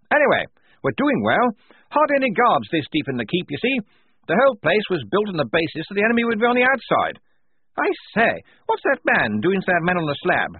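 high, loud hearty officer voice; blustering major. Acted. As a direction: boom out like a jolly old officer.

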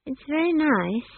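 put-on high, hesitant young girl; uncertain. A narrator's reading. Esk sizes up the staff for Granny, uncertain. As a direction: offer polite praise, unsure, pitched high. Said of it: "she said uncertainly".

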